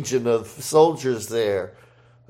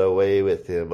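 ordinary talk

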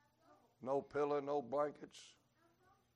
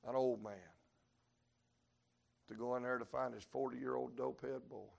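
ordinary talk

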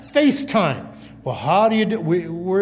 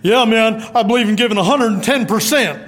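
athlete bravado; 2 semitones higher than usual. Retold or imitated. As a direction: pump it up like a cocky athlete. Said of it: "the athlete that says".

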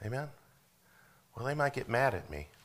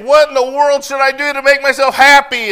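much higher and louder, shouted anguished question; preacher voicing confused young people, shouting their despair; desperate cry; 24 dB louder than usual. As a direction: shout the young person's lost cry at the top of your voice. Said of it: "they look around and they think".

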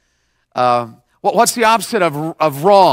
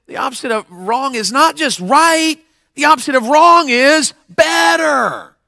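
rising preacher shout; 6 semitones higher than usual. Acted. Preacher builds to climax.

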